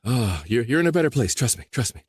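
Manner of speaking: rising, hammed-up consoling; mock reassurance. Joking to a dead character in mock reassurance. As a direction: fake comfort that lifts to a squeak.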